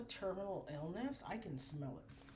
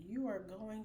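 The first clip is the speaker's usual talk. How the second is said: normal talking voice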